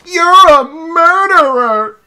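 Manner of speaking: slow, high, loud accusing yell; hammed-up outrage at Meryl; mock accusation.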